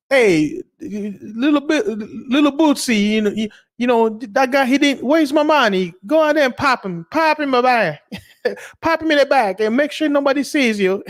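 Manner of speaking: high, hammed-up Italian mobster giving orders; mock mobster. Vlogger imitating an Italian gangster boss, hammed-up mobster voice. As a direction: play a cartoon mafia boss ordering a hit.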